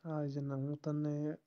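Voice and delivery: usual speaking voice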